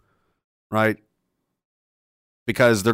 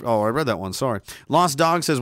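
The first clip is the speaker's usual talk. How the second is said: ordinary talk, nothing marked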